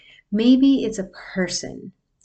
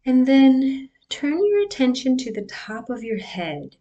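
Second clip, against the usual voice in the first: guided meditation. Acted. Leads a breathing meditation.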